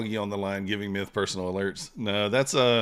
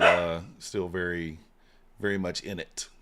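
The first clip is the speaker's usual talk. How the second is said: normal talking voice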